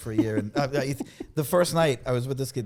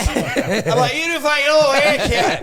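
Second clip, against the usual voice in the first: loud, higher, laughing Boston accent; thick Boston accent; 10 semitones higher, 7 dB louder than usual. Shown as a demonstration. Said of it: "I turn it up".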